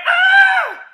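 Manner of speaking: very high, loud mock scream; hammed-up fright shriek. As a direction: shriek as if a bear is chasing you. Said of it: "jump away ... and go".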